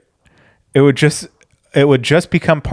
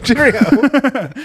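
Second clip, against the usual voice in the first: posh animal voice; 10 semitones higher than usual. Pretend.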